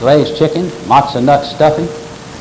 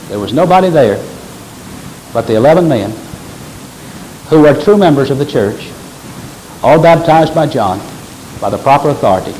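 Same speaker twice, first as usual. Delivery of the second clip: ordinary talk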